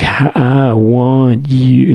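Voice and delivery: sarcastic, drawn-out "I want you" that imitates a rival; mocking. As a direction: make fun of the crooner by dragging it out. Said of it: "he's, like, making fun of the guy".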